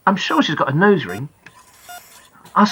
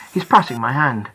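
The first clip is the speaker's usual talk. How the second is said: ordinary delivery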